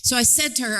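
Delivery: ordinary talk, nothing marked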